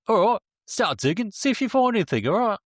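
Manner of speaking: high-pitched put-on officer giving orders; hammed-up briefing, pitched well up; mock police boss. Boss sending young officers to dig, mock brisk orders.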